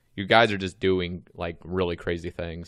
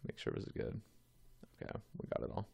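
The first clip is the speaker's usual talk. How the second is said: muttered under his breath to himself; whispered aside; 16 dB quieter, much faster than usual. Real, not acted. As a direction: whisper it quietly, half to yourself. Muttering to himself while checking.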